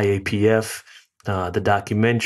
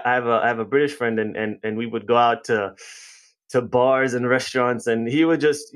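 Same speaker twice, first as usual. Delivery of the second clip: ordinary delivery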